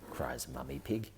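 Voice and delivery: usual speaking voice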